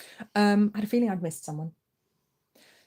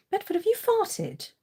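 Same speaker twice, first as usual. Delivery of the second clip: very high-pitched question; high, incredulous voice; shocked pet-talk; 12 semitones higher than usual. Real, not acted. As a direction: squeal an accusation at a pet. Accusing the pet.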